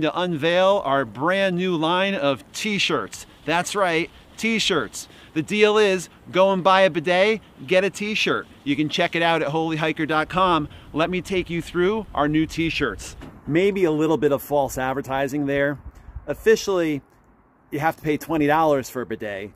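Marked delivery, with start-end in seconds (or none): none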